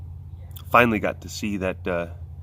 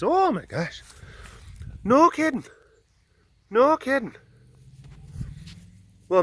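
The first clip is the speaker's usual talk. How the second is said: high excited critter voice; squeaky delighted animal voice; lemur voice; 10 semitones higher than usual. Acted. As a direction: gush like a lemur finding treats. Voicing lemurs' chat.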